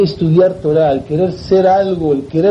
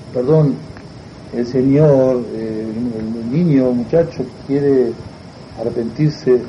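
low, halting and careful; hesitant apology; 7 semitones lower, 3 dB quieter, slower than usual. Acted. Rabbi tells the old man the boy wants out, hesitant low voice. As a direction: feel for each word in a lowered voice.

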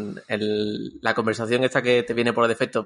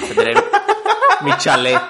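riendo; 6 dB louder than usual. Real, not acted. Joking about owning a chalet.

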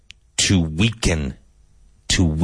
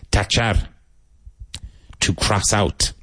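ordinary talk

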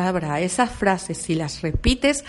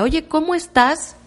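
neighbour asking; 7 semitones higher, 3 dB louder than usual. Retold or imitated. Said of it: "alguien que sepa y que te diga".

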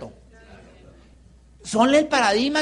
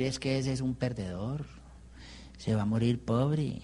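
low, soft mock grumbling to himself; resignado, por lo bajo; 9 semitones lower, 13 dB quieter than usual. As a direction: say it quietly and low, as if writing someone off. Retelling his own defeatist muttering. (entre dientes, con resignación fingida)

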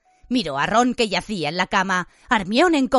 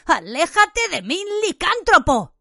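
hostile outburst; 9 semitones higher than usual. A narrator's reading. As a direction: spit it at him. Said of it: "Ron exclamó".

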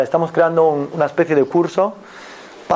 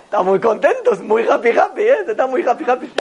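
mock cheerful; 5 semitones higher than usual. Mocking reply to the shouter.

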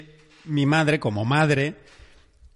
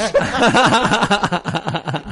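burst of laughter; 6 semitones higher, 6 dB louder than usual. Real, not acted. Awkward laugh at the story.

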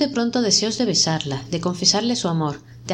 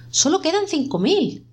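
shocked cry; 9 semitones higher than usual. A narrator's reading. Smith discovers the savings are gone, panicked disbelief. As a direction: pitch shoots up in disbelief.

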